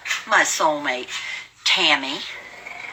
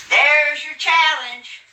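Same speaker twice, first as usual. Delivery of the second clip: louder, high, slow taunt; loud taunting call; 10 semitones higher, 4 dB louder, much slower than usual. Real, not acted. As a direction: drag it out and throw down the gauntlet. Repeats taunt, slower and louder.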